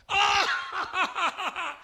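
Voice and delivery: high scornful laugh; mocking laugh. Old friend laughs at the preacher, high and scornful. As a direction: laugh in his face. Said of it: "se reía de mí".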